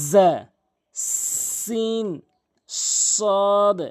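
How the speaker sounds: জা, সিন, সদ each drawn out slowly with the tongue tip between the teeth; teacher sounding out জা, সিন, সদ with careful Arabic articulation; Arabic letter demo. Shown as a demonstration. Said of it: "এই তিনটি হরফ জিহ্বার".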